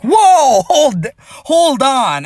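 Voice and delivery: high, loud, slow startled whoa; shocked whoa. Retold or imitated. Retelling spotting the baby post, stunned high cry. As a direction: pull up short in disbelief. Sees her pregnancy post.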